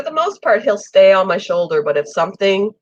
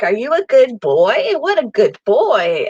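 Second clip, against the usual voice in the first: pet talk; 5 semitones higher, 3 dB louder than usual. Retold or imitated. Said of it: "and I'm like".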